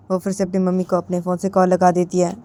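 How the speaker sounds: plain reading voice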